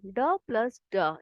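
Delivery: slow, spelled-out ड plus ड; slow teaching demo. Shown as a demonstration. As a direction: break it down slowly. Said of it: "इसे हम कैसे पढ़ते हैं".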